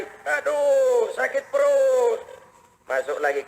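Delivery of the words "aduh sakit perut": long, high bellyache moan; mock groaning. Umar hears another man at a door, mock groaning. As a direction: moan slowly in exaggerated pain. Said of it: "orang ngerintih kayak tadi".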